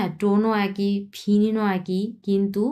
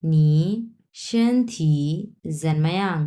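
slow, careful reading as the doctor; doctor's question; 2 semitones lower, 3 dB louder than usual. Acted. Teacher voices doctor asking patient, slow deliberate reading. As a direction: read the doctor's line slowly, word by word. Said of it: "ডাক্তারে question করছে রুগীকে".

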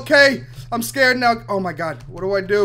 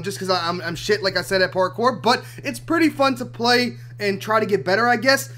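ordinary talk, nothing marked

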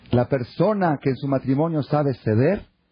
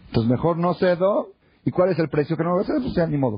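higher-pitched, indignant husband's reasoning; mock defiance; 7 semitones higher than usual. Husband deciding not to yield to his wife, defiant. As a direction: act out a mildly stubborn husband.

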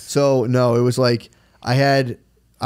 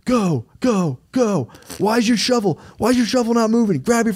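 high mock yell of a pushy boss, go go go; 10 semitones higher than usual. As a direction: bark orders high like a slave-driver boss. Boss yelling to dig faster.

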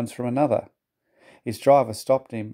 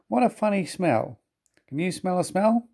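high-pitched, puzzled engine voice; cheeky young engine; 7 semitones higher than usual. Acted. Thomas asks the coaches about a smell, cheeky. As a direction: lift the voice high, nosy and teasing.

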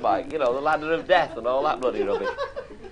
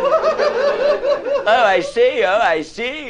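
offended caller; 12 semitones higher, 5 dB louder, much slower than usual. Retold or imitated. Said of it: "he didn't like that".

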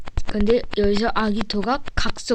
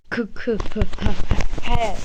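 villain laugh; much slower than usual. Acted. Villain laugh after win.

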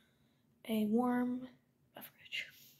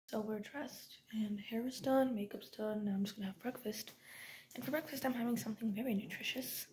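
ordinary delivery

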